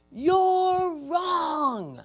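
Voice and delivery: high, drawn-out mock scream; imitated scream. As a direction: imitate a woman yelling an accusation, stretched out. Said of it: "Starts screaming bloody murder at me, yelling at me".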